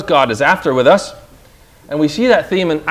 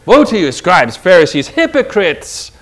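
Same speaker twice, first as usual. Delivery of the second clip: hotter rebuke. Retold or imitated. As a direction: build the heat and hammer each word. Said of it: "he laid into him even again and further and hotter".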